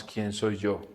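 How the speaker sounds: soft, fading question; hushed doubt. Pretend. Preacher voicing his congregation's doubt in a hush. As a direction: ask it almost to yourself.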